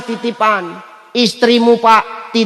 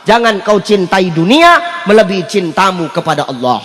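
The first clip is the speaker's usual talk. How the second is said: normal talking voice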